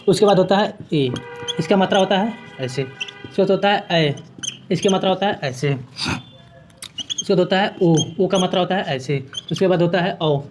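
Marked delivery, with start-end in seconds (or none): 2.8-4.1 slow, softer demo that stretches the vowel ए; drawn-out vowel. Shown as a demonstration. Teacher sounds out the vowel ए slowly for students. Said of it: "teacher sounds out the vowels". 5.7-7.9 very slow, softer demo that stretches the vowel ओ; drawn-out vowel. Shown as a demonstration. Teacher models the vowel ओ slowly for students. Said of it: "teacher sounds out the vowels".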